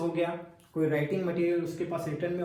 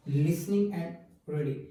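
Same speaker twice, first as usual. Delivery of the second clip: slow, careful naming of key terms; spaced out for students to note down; slow and deliberate; much slower than usual. Shown as a demonstration. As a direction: spell out each word slowly, like dictation. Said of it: "teacher stresses key terms".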